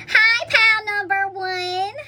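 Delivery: slow drawn-out high greeting; sing-song greeting. Real, not acted. Reader greeting pal one, slow sing-song hello.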